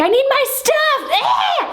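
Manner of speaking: very high, screechy mock yell; mock screaming. Drunk ex demanding her stuff on the stairs, high mocking scream. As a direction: screech it like a hysterical ex, without real volume. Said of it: "she's yelling and she's screaming".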